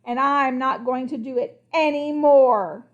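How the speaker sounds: loud, slow furious outburst; lost temper. Acted. Crocodile loses his temper at the king, loud and slow. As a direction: blow up and refuse. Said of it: "He lost his temper".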